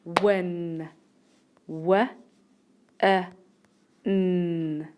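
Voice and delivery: "Win", then "W", "I", "N" as separate sounds; sounding out. Shown as a demonstration. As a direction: break "Win" into short "W", short "I", held "N". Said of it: "Listen to the first syllable".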